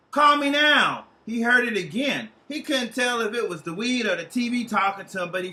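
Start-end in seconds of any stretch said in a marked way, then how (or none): 0.1-1.1 put-on slow, louder Jamaican accent, higher. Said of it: "carried over by a Jamaican accent".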